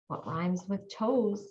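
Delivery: ordinary delivery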